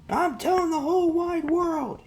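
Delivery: bully yelling back while riding away; shouted taunt. Acted. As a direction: holler it back as you ride off. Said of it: "he shouts back over his shoulder".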